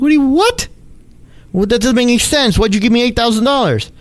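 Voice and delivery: high, fast disbelief at dad; incredulous. Retold or imitated. Son questioning his dad's lesson, high fast incredulous. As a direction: sputter in disbelief. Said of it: "I'm like".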